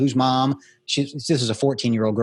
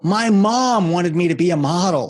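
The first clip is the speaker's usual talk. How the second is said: retold tearful cry, higher and breaking; 5 semitones higher, 4 dB louder, slower than usual. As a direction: sob the words out as the girl. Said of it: "and she's crying".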